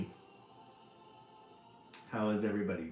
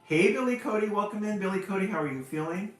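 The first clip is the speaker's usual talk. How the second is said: high, bright-voiced welcome, louder; excited greeting; 11 semitones higher, 7 dB louder than usual. Real, not acted. Host welcomes a viewer to the stream, excited and high. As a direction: call out a cheery hello to a new arrival.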